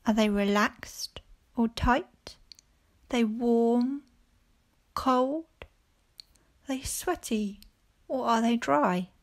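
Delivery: normal talking voice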